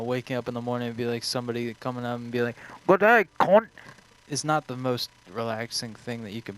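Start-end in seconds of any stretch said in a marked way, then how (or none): 2.9-3.7 loud, much higher Australian greeting; hammed-up Australian accent, shouted. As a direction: bark it like a brash Aussie waking you up. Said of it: "I would say Australian".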